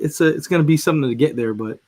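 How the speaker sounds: usual speaking voice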